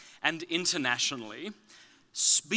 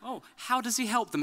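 curious friend; 8 semitones higher, 5 dB louder than usual. Retold or imitated. Mum's friend asking at tea.

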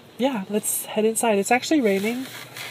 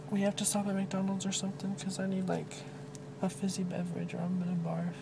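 usual speaking voice

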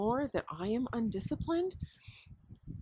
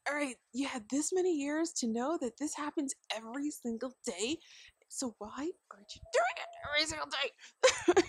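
mock nagging mom; 7 semitones higher than usual. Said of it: "I'm like".

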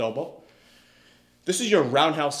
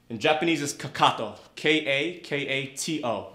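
normal talking voice

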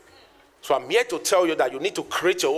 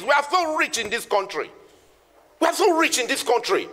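high, ringing preacher's cry; fiery motivational preaching; preaching at full force; 7 semitones higher than usual. Acted. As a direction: drive it home to the crowd like a revival sermon.

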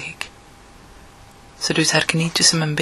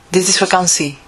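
a touch louder, declaring it to the street; said loudly. A narrator's reading. Narrator announcing it is a holiday, a little louder. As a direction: proclaim it aloud, lightly raised. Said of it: "zeg ik luid".

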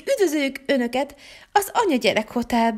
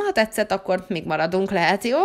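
ordinary talk

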